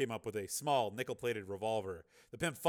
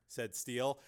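ordinary delivery

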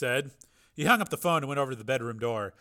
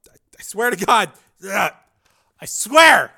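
high, loud, gasping pain; wounded man in pain; 8 semitones higher, 15 dB louder than usual. Acted. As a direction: cry out through the agony.

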